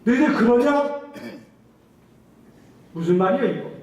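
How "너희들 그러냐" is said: high, loud, challenging voice of God; booming divine rebuke, higher and louder; God's stern reply. Retold or imitated. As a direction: voice God throwing the question back at them. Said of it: "하나님께서 뭐라고 말합니까".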